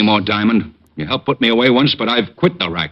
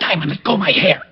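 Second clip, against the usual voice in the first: pained, strained plea, higher; 5 semitones higher than usual. Acted. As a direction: yelp it out while your hair is yanked. Diamond is pulling his hair.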